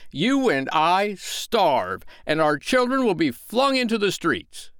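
high, emphatic warning tirade; stern. A narrator's reading. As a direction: lay down a stern, melodramatic ultimatum. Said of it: "he went on sternly".

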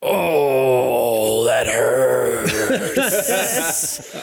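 pained groan through teeth, breaking into a laugh; mock wounded; gritted pain. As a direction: Bob hit by two arrows, hammed-up hurt. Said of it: "you hear him, like, grit his teeth".

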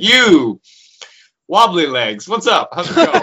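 high, loud, bouncy hail; hailing a scene partner by a silly nickname, cheerful and loud; cheery greeting. Retold or imitated. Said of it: "So I'll be like".

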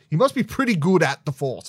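mocking quote. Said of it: "says to Grogu".

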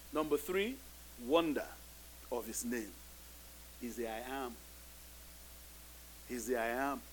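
ordinary delivery

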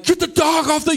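high, loud retold yell at the kids. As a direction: act out losing your temper over the dog. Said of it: "I'm yelling".

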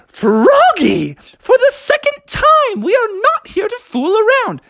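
high, prim scolding nanny; Mary Poppins. Acted. Nanny scolding Froggy for fooling around, high and prim. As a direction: trill a stern telling-off.

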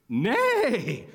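high whinny that falls away; whinny like a horse; rising then falling horse cry; horse neigh. A narrator's reading. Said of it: "said a horse".

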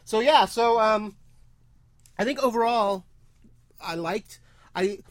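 halting, with long gaps and restarts; tongue-tied. Real, not acted. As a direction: grope for the words and break off. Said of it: "He's, like, tongue-tied".

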